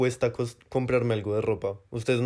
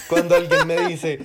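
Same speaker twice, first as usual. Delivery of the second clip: laughing; 5 semitones higher, 6 dB louder than usual. Real, not acted.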